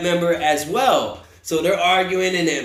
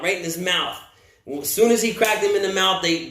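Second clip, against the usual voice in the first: ordinary talk, nothing marked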